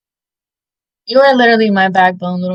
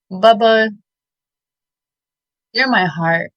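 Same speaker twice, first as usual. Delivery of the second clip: ordinary talk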